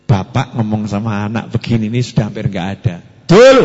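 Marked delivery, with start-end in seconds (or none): 3.3-3.6 put-on loud barked call to a child; harsh shout. Shown as a demonstration. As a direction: yell the name. (bentakan keras memanggil anak)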